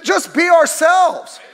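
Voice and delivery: slow, high, loud shout. Real, not acted. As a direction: bellow it out.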